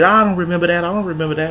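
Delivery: high, put-on innocent voice; mock innocent denial. Mimicking the girl's fake denial, high and innocent. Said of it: "She pretended".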